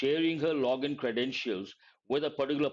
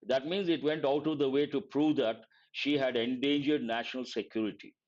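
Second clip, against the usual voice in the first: ordinary talk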